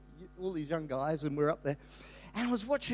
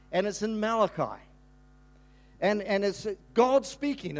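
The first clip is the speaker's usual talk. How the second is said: ordinary talk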